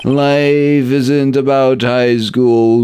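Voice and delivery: high, drawn-out lonely lament; self-pitying officer. Acted. Officer moping to the detective about being alone, high and slow. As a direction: wallow in being all alone.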